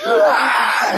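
sudden high yelp of surprise; golfer's high startled whoop; excited yelp. Retold or imitated. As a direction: let out a leaping high cry. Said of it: "He's like".

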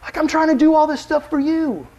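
high, loud, flustered hostess voice; mock-frantic Martha fretting over the house. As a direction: panic about the perfect house. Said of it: "And she's like".

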